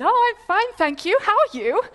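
high, loud, bright mock-polite voice; hammed-up polite small talk; fake polite front. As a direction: put on a breezy fake politeness. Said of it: "put on a bit of a front".

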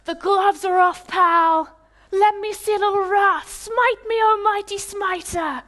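loud, high mock-defiant shout at the sky; hammed-up Bruce Almighty taunt at the sky; defiant challenge to God. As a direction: arms out, dare heaven to strike you. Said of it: "he's like".

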